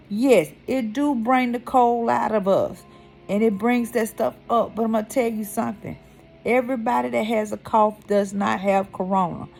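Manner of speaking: ordinary talk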